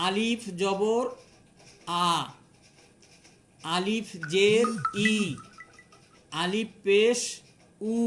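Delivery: "আলিফ জের ই", drawn out and rising, pause, then the vowel; "আলিফ পেশ উ" spelt out with rising sign name, vowel set apart; pronunciation demonstration. Shown as a demonstration. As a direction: recite "আলিফ জবর আ" piece by piece as a teacher's model. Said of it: "তাহলে আমরা এভাবে উচ্চারণ করব".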